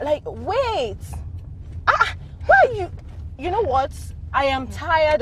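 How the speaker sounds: high, exasperated interruption. Acted. Woman tired of her friend discussing George, exasperated. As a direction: cut in, fed up.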